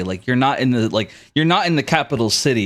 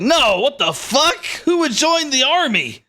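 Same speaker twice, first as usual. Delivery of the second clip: high, stretched-out outrage; appalled bandit's hammed-up cry; yelling in disbelief; 12 semitones higher than usual. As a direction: protest as if the idea is insane.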